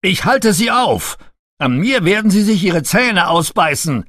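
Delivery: high, loud defiant shout. A narrator's reading. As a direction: shout it out bravely. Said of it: "schrie Sir Robert ihr zu".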